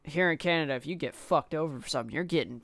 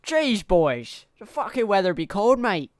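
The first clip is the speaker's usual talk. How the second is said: loud, high-pitched attempt at Australian; mock Australian accent; 6 semitones higher, 8 dB louder than usual. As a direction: shout it in an exaggerated Australian accent. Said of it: "That sounds like Australian".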